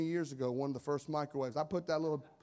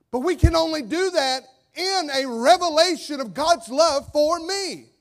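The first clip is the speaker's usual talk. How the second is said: loud, high preaching that builds to a roar; full-force preaching; 10 semitones higher, 12 dB louder than usual. Acted. Pastor preaching hard to his congregation, loud and high. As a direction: preach it out to the whole church.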